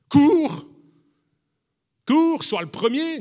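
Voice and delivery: mocking a demanding master, shouted. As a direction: yell it like a pushy coach, slowing. Mock command God never gave.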